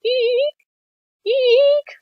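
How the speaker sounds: very high, drawn-out squeal; imitated camera sound. Film fan copying the opening camera noise, high eerie squeal. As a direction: mimic a creepy Polaroid whine, far above your voice. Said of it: "It's a really creepy sound".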